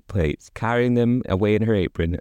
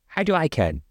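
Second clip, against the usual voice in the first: crossly; 7 semitones higher than usual. A narrator's reading. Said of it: "answered the wife crossly".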